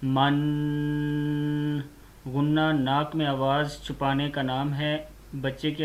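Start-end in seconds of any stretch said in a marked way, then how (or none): 0.0-1.8 मन with the n hummed through the nose; nasal ghunna. Shown as a demonstration. Teacher to a child learning Qaida, nasal ghunna on मन. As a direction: let मन resonate in the nose. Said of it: "गुन्ना कहते हैं जैसे मीम दो ज़बर".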